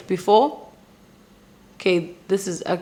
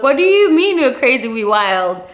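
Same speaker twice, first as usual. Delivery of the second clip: mock indignant; 5 semitones higher, 6 dB louder than usual. Mock-offended retort to stranger.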